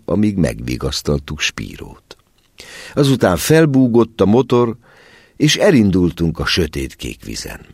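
ordinary delivery

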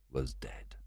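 hushed, low whisper. A narrator's reading. Narrator telling of Nancy's death in a whisper. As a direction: breathe the words out.

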